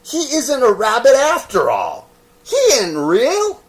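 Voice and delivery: accusing wild rabbit. Acted. Wild rabbit declaring the toy not real, high and loud. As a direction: denounce him.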